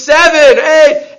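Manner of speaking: high, loud shout of correction; retold crowd scream. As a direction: yell it out like a whole congregation. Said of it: "and everybody screams".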